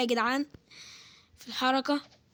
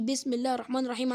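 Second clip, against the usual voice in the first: ordinary talk, nothing marked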